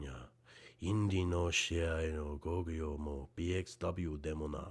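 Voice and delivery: earnest speech to his people in Japanese; 心を込めて語りかける; heartfelt address. Real, not acted. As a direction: speak from the heart to the home crowd. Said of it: "in my native language".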